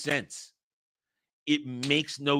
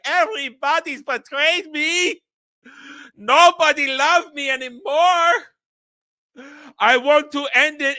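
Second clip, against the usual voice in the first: mock-tragic outcry, stretched and high; melodramatic despair; 9 semitones higher, 7 dB louder than usual. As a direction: loudly overplay a betrayed man's anguish. Acting out John's melodramatic breakdown.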